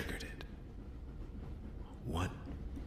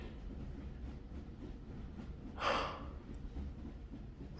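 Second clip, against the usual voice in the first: long heavy sigh; 10 dB quieter than usual. Acted. Detective realizing grim truth.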